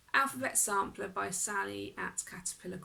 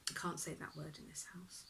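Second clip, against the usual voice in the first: hushed whisper so the dogs don't hear; 3 semitones lower, 9 dB quieter than usual. Real, not acted. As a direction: drop to a secret whisper. Dogs must not hear the word.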